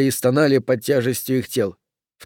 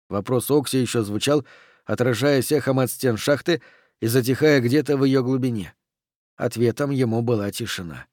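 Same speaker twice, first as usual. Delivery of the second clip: usual speaking voice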